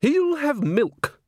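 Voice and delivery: slow, high and firm. A narrator's reading. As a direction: lay it down as final. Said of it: "said Granny firmly".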